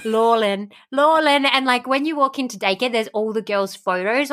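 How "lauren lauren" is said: Lauren said as lol-in, the r turned to l; her Lauren. Shown as a demonstration. Friend asks her to say Lauren her way, Lauren as lol-in. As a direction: say Lauren like lol-in, in a broad accent. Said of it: "I just love how you say Lauren".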